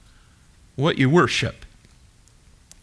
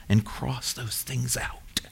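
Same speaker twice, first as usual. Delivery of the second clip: whisper; 4 semitones lower, 11 dB quieter, slower than usual. Real, not acted. Savouring crossing tasks off list.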